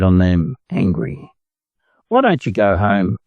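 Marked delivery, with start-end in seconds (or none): none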